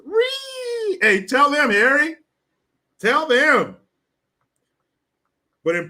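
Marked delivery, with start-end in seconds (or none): 0.1-3.8 shrill shouted parody, much higher and louder; hammed-up outraged yelling; mock outraged shriek. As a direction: screech like an offended activist. Mocking outraged activist.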